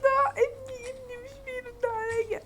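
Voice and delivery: high, wailing mother voice, sobbing at the end; distraught mother. Acted. Frantic mother tells the officer her son is lost, high wailing sob. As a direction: play a hysterical mother losing her son.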